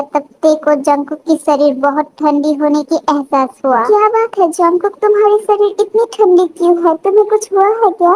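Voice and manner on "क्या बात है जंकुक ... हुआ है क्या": higher, quick worried questions; concerned wife. Acted. Wife finds husband's body cold, concerned questioning. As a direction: ask with puzzled concern in a lifted voice.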